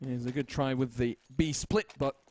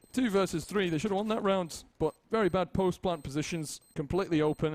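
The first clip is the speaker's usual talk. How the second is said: Bob's voice; 6 semitones higher than usual. Pretend. Fake co-caster answers host.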